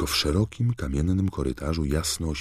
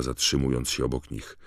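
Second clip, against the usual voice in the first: usual speaking voice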